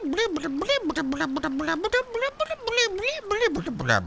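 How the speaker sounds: very high, repeated blip noises; imitated blips. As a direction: squeak out the blips like a signal. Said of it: "That's what I heard".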